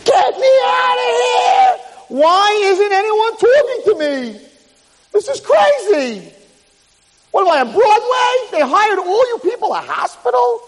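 high, drawn-out hysterical shouting; screaming. Acted. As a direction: scream for someone to listen. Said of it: "and he's screaming".

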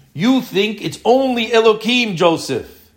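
high, emphatic fatherly rebuttal; acted challenge. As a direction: challenge a son's belief, pitched well up. Acts Jacob challenging Joseph's view.

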